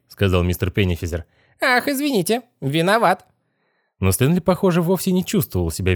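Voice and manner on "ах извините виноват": very high, insincere squeaky apology; nasty squeal. A narrator's reading. Possessed boy not sorry at all, squealing apology. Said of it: "мерзким визгливым голосом".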